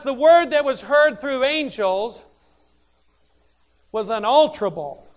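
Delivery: loud, ringing preacher's voice; preaching at full force. Real, not acted. Preacher quoting Hebrews to his congregation, at full force. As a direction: proclaim it to the whole church.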